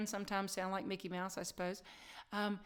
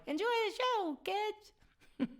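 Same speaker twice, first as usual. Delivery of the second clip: high cartoon squeak, louder; Mickey Mouse; 13 semitones higher, 4 dB louder, much slower than usual. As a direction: squeal it like a cheery cartoon host. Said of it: "so here you go, Mickey".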